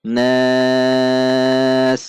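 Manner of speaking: drawn-out Arabic nas with nasal hum; Quran recitation. Shown as a demonstration. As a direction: recite nas slowly with tajwid. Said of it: "Mari kita baca".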